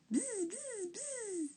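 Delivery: bzz très aigu et doux; 1 bourdonnement aigu. A narrator's reading. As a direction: bourdonne comme une abeille, très haut. Abeille imitée dans la réplique. (bourdonnement aigu d'abeille)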